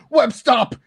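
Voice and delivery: high, loud "Web stop!" yelled in panic; flailing superhero yelling at his own webs; mock panicked yell. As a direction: yell it like you can't control the webs. Quoting Spider-Man crashing around.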